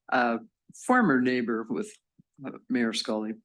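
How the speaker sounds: ordinary talk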